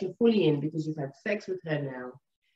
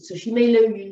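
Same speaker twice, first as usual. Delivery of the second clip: usual speaking voice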